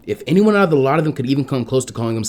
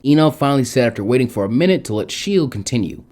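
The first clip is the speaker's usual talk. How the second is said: ordinary delivery, nothing marked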